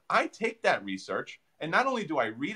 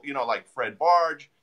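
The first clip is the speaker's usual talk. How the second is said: usual speaking voice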